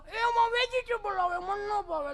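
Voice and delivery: high, slow bratty whine; hammed-up high-pitched complaint; whining child. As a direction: imitate a fussy spoilt kid. Spoilt child objects to vegetables, acted.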